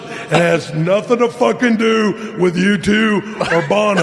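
high loud hammed-up rock star voice; hammed-up rock frontman. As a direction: confess like a weepy rock star. Mock confession in [name] voice.

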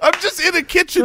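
high-pitched mock protest. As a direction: plead your case, voice pushed up high. His exasperated reply to his wife.

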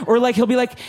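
ordinary talk, nothing marked

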